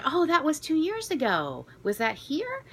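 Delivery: high, bright mock-excited quote; excited staff woman. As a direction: imitate her surprised delight, pitched well up. Retelling the staff woman's words.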